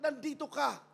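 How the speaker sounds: ordinary talk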